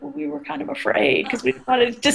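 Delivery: a hint of shy kids owning up; sheepish students. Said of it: "They're like".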